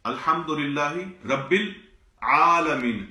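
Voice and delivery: العالمین said slowly with a clear throaty ain after il, no lam; teacher demo of العالمین with ain, not lam. Shown as a demonstration. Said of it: "یہاں عین ہے".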